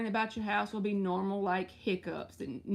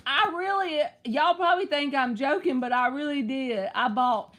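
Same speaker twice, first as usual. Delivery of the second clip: much higher and louder, laughing emphasis; excited insistence; 5 semitones higher, 7 dB louder than usual. Real, not acted. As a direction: burst out insisting it's true. Laughing insistence about buying aloe water.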